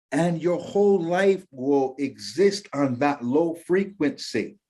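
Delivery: ordinary talk, nothing marked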